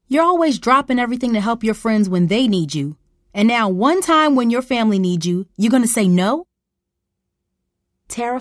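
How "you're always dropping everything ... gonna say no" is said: loud, high accusing scold; indignant scolding. Acted. Mom accusing Tara of abandoning family, loud indignant scold. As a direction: throw the accusation at her, loud.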